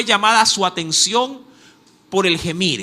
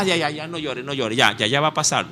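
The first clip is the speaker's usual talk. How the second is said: consuelo fingido; 6 semitones lower, 4 dB quieter, faster than usual. Retold or imitated. Imita consuelo superficial a Dios.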